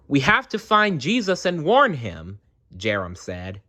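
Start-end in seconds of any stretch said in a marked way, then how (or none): 0.1-2.4 put-on high, hurried boy's urging; rally your friends to hurry; urgent child. Jarom urging friends to warn Jesus, urgent.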